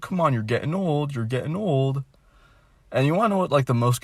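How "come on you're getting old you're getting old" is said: higher, pushy mock nag; mock nagging relative. Vlogger imitates family pushing him, mild mock nagging. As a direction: lightly mimic the "you're getting old" nag. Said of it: "It's like".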